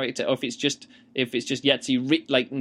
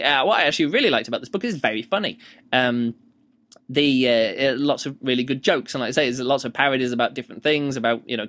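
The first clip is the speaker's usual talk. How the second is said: normal talking voice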